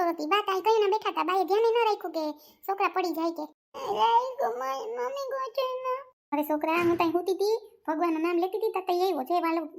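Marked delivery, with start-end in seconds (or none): 3.9-6.1 high-pitched hurt whining; child's wail. Acted. Fallen child complaining to mother, high wailing whine.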